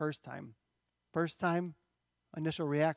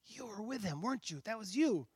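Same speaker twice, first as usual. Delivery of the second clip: hushed, slightly higher accusing voice; servant girl; 3 semitones higher, 8 dB quieter than usual. Acted. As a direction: softly point him out, as the girl. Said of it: "this girl comes up to him and says".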